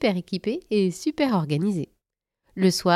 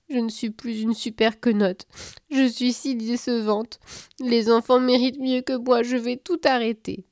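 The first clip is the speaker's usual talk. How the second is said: moaning despair; 5 semitones higher than usual. Acted. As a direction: wail that you're a failure. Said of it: "gémit-elle".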